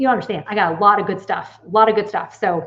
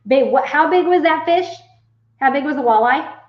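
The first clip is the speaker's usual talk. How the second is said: louder and higher, calling across the room; raised voice asking babe; 6 semitones higher, 5 dB louder than usual. Real, not acted. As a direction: call out to your partner in the next room. Calling to partner off-mic.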